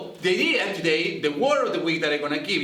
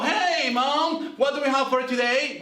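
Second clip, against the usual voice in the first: put-on eager kid; 8 semitones higher than usual. Voicing a kid asking mom.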